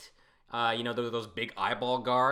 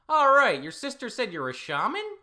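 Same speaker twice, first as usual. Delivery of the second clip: higher voice; 14 semitones higher, 9 dB louder than usual. Acted. Character voice, higher.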